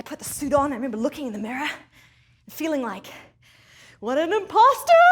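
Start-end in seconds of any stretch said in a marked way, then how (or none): 4.0-5.0 mocking exclamation. Mocking her own reflection.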